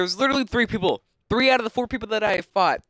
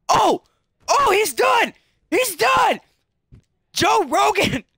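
high, shouted fight-commentator call; Joe Rogan; 11 semitones higher, 6 dB louder, much slower than usual. Acted. Gamer calling a knockdown as a famous commentator, excited shouting. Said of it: "How do you like my Joe Rogan impression".

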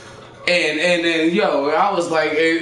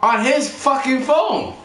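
high, drawn-out disbelief; slow, scandalized storytelling punch; incredulous emphasis; 9 semitones higher, slower than usual. Real, not acted. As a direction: stretch each word high to land the twist. Outraged reveal, repeated for effect.

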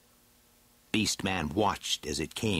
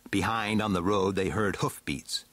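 plain reading voice

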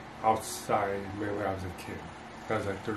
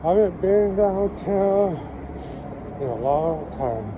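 loud; 5 semitones higher, 5 dB louder than usual. Real, not acted. Loud complaint downtown.

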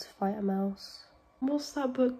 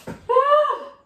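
high, loud yelp of fright; sudden shriek at the mouse; startled scream; 9 dB louder than usual. Real, not acted. Vlogger startled by a mouse, startled scream.

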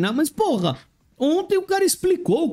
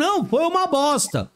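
ordinary delivery

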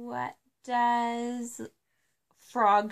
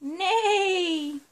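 high, loud drawn-out neigh; whinny like a horse; 7 semitones higher, 6 dB louder than usual. Acted. Parent neighs for toddler.

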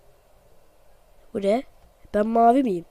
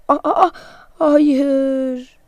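dismayed cries; 4 semitones higher, 6 dB louder, much slower than usual. Real, not acted. Losing the water task.

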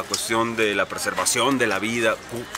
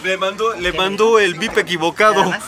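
mock dramatic; 6 semitones higher, 6 dB louder than usual. Retelling Fury's wrong beeper.

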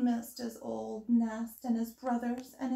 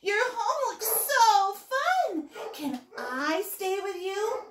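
high, slow, louder gushing; eager little owl; 8 semitones higher, 4 dB louder, slower than usual. A narrator's reading. Little Owl asks to stay with Frog, eager and high. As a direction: draw it out high, a hopeful little character.

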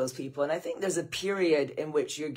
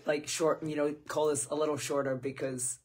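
ordinary talk

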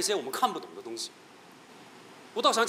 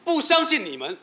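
rising anger; 8 semitones higher than usual. Acted. Escalating accusation at the doctor.

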